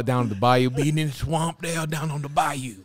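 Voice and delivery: put-on Cajun swamp talk; Louisiana accent. Describing the puppet's swamp home, Louisiana accent. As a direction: talk like a bayou gator from Louisiana. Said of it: "Give me a Louisiana accent".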